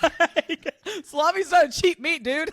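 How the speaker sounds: high, loud laughing talk. Real, not acted. Owner joking about salami for the dog, laughing loudly. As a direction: keep laughing while you complain.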